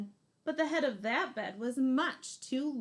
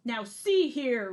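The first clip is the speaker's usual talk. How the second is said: much louder slow roar; 10 dB louder, slower than usual. Acted. Papa Bear confronts the girl in bed, loud slow roar. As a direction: roar it slowly, big bear. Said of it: "roared Papa Bear".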